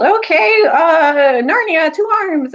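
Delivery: high, loud call to arms; rally the army with a shout; battle cry. Retold or imitated. Peter rallying Narnia to battle, loud high shout. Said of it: "Peter all of a sudden yelled".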